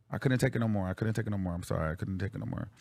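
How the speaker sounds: low voice fading to very soft; real worn-out confession. Real, not acted. Confessing to viewers about the false rumour, worn-out and fading. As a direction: let each repeat sink further, as if spent. Admits reaching breaking point on stream.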